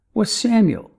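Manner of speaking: ordinary talk, nothing marked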